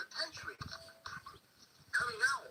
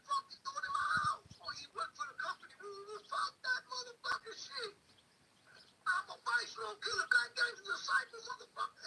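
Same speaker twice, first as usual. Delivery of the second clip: higher, hammed-up angry street voice; mock gangster outrage; 9 semitones higher than usual. Residents spotting a rival gangster at their door, higher mock angry shout. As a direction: act out the residents' angry outburst.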